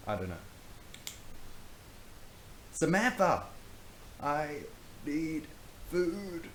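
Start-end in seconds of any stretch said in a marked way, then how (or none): 2.7-3.4 loud alarmed cry of a name; calling out. Acted. Friend shouting to fallen Samantha, loud alarmed call. 4.2-6.5 weak croaky plea for food. Acted. Fainting girl begging friends for food, croaky. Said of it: "said Samantha croakily".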